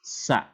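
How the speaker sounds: "Sa" with tongue tip on upper teeth. Shown as a demonstration. Teacher drilling the letter, tongue-tip "Sa". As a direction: press tongue to top teeth for a short "Sa". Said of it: "dirapatkan dengan gigi atas".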